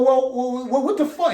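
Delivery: high-pitched retold disbelief; exasperated protest. Inmate demanding an explanation from another inmate, exasperated high protest. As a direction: stammer into a high, baffled objection. Retelling his baffled objection.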